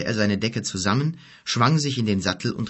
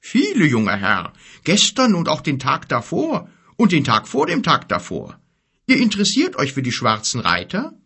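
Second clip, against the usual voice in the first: giant voice; 5 semitones higher, 4 dB louder than usual. A narrator's reading. Giant Gorg speaks.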